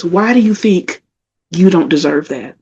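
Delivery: usual speaking voice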